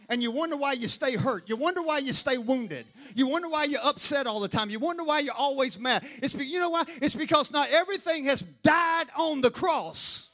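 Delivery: rising sermon build; preaching. Acted.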